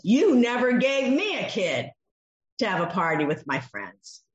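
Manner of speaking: a resentful son insisting he never failed; emphatic never. Shown as a demonstration. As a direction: lean hard on never and at any time. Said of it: "it is an emphatic negative".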